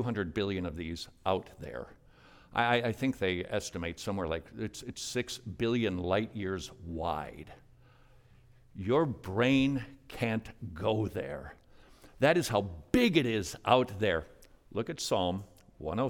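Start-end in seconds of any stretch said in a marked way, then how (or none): none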